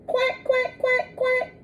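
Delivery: high soft little quacks; duckling quacks. Acted. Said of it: "say the ducklings".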